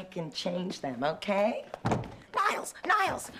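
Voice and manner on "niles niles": urgent call. Real, not acted. Calling the butler urgently.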